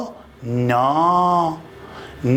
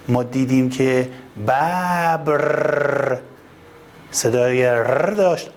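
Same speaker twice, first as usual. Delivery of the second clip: normal talking voice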